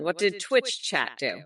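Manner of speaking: ordinary talk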